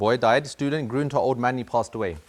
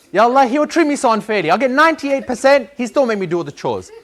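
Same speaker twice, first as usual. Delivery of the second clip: high, loud whiny grievance with a laugh; laughing complaint; 9 semitones higher, 7 dB louder than usual. Retold or imitated. Boy laughing as he complains to Allah. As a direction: laugh through the grievance, pitched up.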